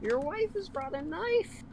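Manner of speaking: villain taunt. Pretend. Dramatic game-villain taunts.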